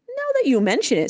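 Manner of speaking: high, musing start to an admission; hesitant, slow admission. A narrator's reading. Local starts admitting to the maggid, said slowly. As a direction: ease into a reluctant admission. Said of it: "said the local slowly".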